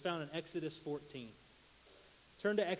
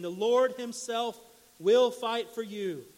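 louder, slow, "Lord" held high; ringing proclamation; 4 semitones higher, 9 dB louder, slower than usual. Acted. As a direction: proclaim it like a battle cry. Moses proclaims God's fight.

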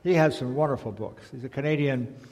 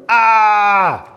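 loud, high scream; long open-throated child's yell; all-out yell; 8 semitones higher, 14 dB louder than usual. A narrator's reading. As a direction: scream "Ah!" as loud as you can. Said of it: "yelled as loud as he could".

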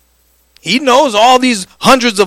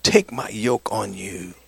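soft tender; 10 semitones lower, 9 dB quieter, slower than usual. Acted. Soft scripture quote.

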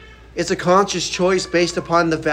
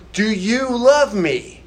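gentle question; 5 semitones higher, 3 dB louder, much slower than usual. Retold or imitated. Preacher voicing Jesus asking Peter, slow and gentle. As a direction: ask it tenderly, with no accusation. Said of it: "it's a gentle question".

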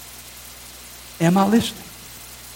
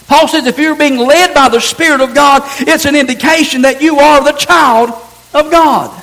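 higher and louder, building fervor; preaching at full force; 5 semitones higher, 4 dB louder than usual. Acted. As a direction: drive it home to the congregation.

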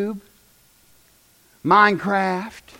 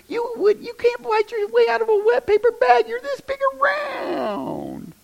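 much higher, slowing mock voice; mocking taunt; 13 semitones higher, 3 dB quieter than usual. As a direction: belittle the little keyboard tough guy. Mocking a scrawny online tough guy.

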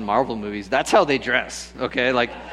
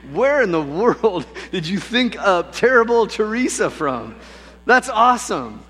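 high, emphatic mock astonishment; retold amazed delight; 8 semitones higher, 4 dB louder than usual. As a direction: act out a dad's thrilled disbelief. Dad acts out delighted reaction.

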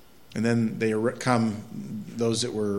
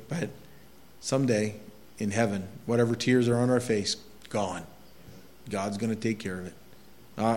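normal talking voice

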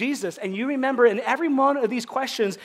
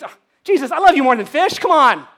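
breezy mock answer; 5 semitones higher, 9 dB louder than usual. Peter's easy flippant answer.

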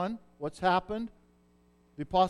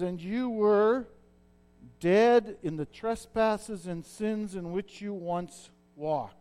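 solemn scripture reading; 3 dB louder, slower than usual. Acted. Preacher reads scripture slowly.

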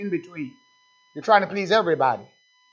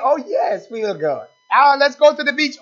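much higher and louder, mock delight; mock gleeful plan, higher and louder; hammed-up eagerness; 10 semitones higher, 9 dB louder than usual. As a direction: play a pleasure-seeker who loves outings. Mimics eager worldly churchgoers.

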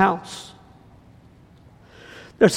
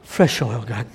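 prophetic prayer; 3 semitones lower, much slower than usual. Acted. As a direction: pray fresh oil over them.

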